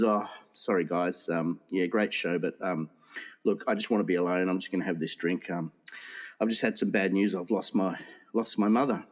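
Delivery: low, downcast acted voice; subdued, grieving. Headliner to support act after bad news, acted subdued. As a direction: turn them down gently, low and heavy. Bereaved headliner declines company in joke.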